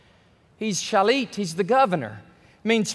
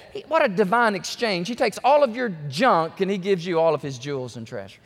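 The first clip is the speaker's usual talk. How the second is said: ordinary talk, nothing marked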